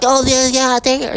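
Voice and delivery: high, airy mock child; mock childish voice. Mocking a child who shrugs off cruelty, high mock voice.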